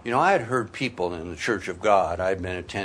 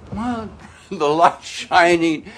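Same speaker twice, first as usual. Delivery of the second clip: excited; 9 semitones higher, 8 dB louder than usual. Retold or imitated. Retold inner voice awakening.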